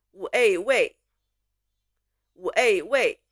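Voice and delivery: "五A位" sounded out part by part, then blended; spelling aloud. Shown as a demonstration. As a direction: model "五A位" for learners to repeat. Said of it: "Spell after me".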